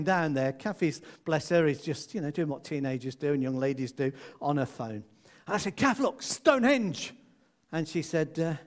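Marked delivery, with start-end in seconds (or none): 5.8-7.1 calling out. Retold or imitated. Dad points out Stonehenge in car.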